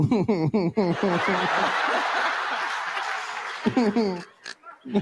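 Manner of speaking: long run of high, pulsing giggles; hammed-up smitten tittering; acted giggle. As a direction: giggle helplessly, as if smitten by the GPS voice. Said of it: "And I'm just like".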